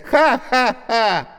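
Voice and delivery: sarcastic forced ha-ha-ha, high and slow; fake laugh. A narrator's reading. Woman mocking the prank with a fake laugh. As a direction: laugh pointedly, not amused. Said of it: "я посмеюсь над вашей шуткой".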